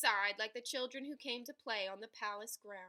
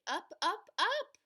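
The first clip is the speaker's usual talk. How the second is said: rising higher and higher; 8 semitones higher, 4 dB louder than usual. A narrator's reading. Narrator lifting the voice as the princess floats up. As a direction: voice climbs with each up.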